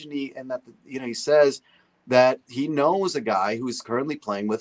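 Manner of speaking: ordinary talk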